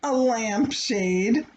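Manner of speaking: plain reading voice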